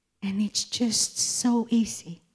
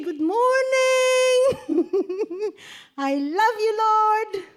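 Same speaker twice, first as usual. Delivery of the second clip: high, crying call of good morning to the Lord, breaking into a laugh; tearful joyful greeting; 9 semitones higher than usual. Retold or imitated. Greeting the Lord in the morning, tearful and high. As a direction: sob it out joyfully, much higher. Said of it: "you say".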